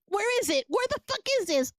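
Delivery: rising to a high, fast frantic outburst; frantic anger. Acted. Finding no mark on the hand, a high frantic rant. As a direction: lose it as the plan falls apart. Finds no witch mark, panicking.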